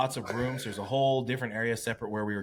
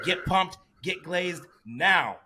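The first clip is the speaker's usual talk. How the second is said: a higher, louder imitated order, slowing right down on get glazed now; imitated backstage crew ordering the athlete, higher and louder; barked order; 7 semitones higher, 4 dB louder, much slower than usual. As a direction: mimic a rushed backstage crew member hurrying him along. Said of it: "They're like".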